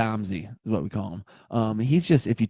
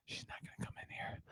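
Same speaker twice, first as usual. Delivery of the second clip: whisper; 26 dB quieter, faster than usual. Real, not acted. Aside whisper.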